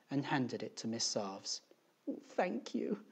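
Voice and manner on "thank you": high, soft thank-you; small high voice of an upset teacher; tearful thanks. A narrator's reading. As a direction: thank them softly, close to tears. Tearful teacher takes a handkerchief.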